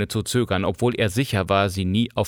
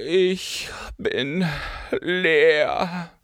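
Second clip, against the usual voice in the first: higher, hollow, dragged-out lament; sepulchral voice; 7 semitones higher, much slower than usual. A narrator's reading. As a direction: tragic actress playing the grave. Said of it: "begann sie mit Grabesstimme". (mit Grabesstimme)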